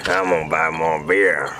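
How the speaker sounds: put-on low, slow, chuckling drunk; homeless drunk. Homeless man just handed five dollars, slow drunken rumble. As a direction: drag it out deep and boozy.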